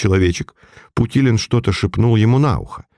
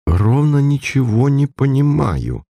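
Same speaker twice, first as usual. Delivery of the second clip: high, slow admission of bafflement; sincere outburst; 6 semitones higher than usual. A narrator's reading. Baffled friend answering the detective, sincere high-pitched blurt. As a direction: let it out frankly, pitched up and drawn out. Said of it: "искренне вырвалось у меня".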